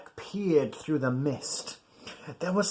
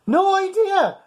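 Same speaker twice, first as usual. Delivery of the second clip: high, loud panicked yell; 7 dB louder than usual. Acted. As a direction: yell it back in alarm. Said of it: "yelled the skipper".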